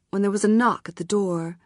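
usual speaking voice

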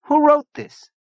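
very high, loud, astonished question; amazed. A narrator's reading. Zona marvelling at Saint Mark's, astonished. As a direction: burst out in amazement.

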